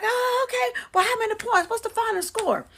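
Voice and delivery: high, loud eager questions; excited boys. Retold or imitated. Basketball boys asking the board for the final score, high and loud.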